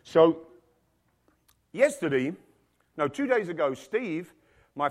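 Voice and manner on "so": ordinary delivery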